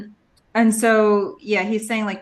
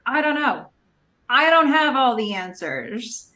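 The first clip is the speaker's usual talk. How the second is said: higher-pitched mock-angry retelling; 5 semitones higher than usual. Host retells Ben losing it at Felicity, mildly angry. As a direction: imitate his exasperated anger, pitched up. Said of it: "He's angry".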